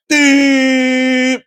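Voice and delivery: high sung ding like a bell; bell ding sound. Retold or imitated. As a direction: chime it out high.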